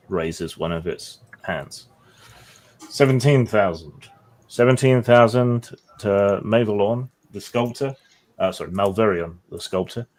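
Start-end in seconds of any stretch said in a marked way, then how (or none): 2.9-7.9 brisk auction caller voice; auctioneer. Acted. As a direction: call the bids like an auctioneer. GM plays auctioneer.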